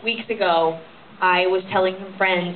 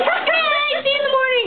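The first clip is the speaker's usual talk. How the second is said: much higher, sing-song cheerful partner voice; a bubbly, carefree bedtime goodbye pitched way up; chirpy partner. Retold or imitated. Partner saying goodnight, in a much higher chirpy voice. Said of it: "you're like".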